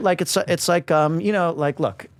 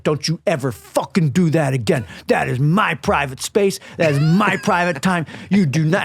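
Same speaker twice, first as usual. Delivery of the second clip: angry rant; 3 semitones higher than usual. Retold or imitated. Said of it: "going off on me".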